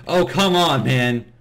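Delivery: loud, fed-up "come on"; exasperated groan. Real, not acted. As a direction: throw up your hands, annoyed. Frustrated at dying in game.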